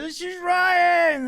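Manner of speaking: very high, loud, drawn-out mock announcement; shouted introduction. Piper introducing his new friend to the crowd, imitated shout. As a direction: bellow the introduction slowly like a proud announcer.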